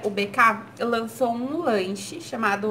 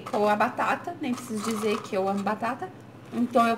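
chewing while talking; speaking between bites; mouth full of fries. Real, not acted. Seen 0: Holds fries, puts them in mouth and chews through 2.5 s.

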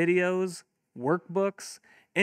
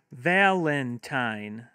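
Valentine very slow, three drawn-out beats; slow syllables; 2 semitones lower, much slower than usual. Shown as a demonstration. Teacher modelling clapping for kids, Valentine in slow beats. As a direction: stretch Valentine into three claps. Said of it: "have your kids clap".

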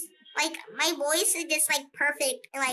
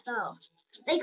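ordinary delivery

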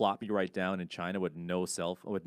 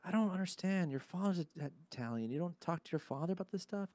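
puzzled teacher; 11 semitones higher, 4 dB quieter than usual. Retold or imitated. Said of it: "She's like".

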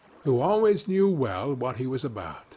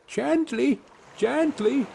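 high soothing calls; little boy calling to slow a horse; calling gently; 10 semitones higher, slower than usual. A narrator's reading. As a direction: coax the horse from its ear. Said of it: "Tom was calling out".

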